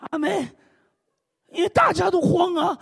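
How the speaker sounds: normal talking voice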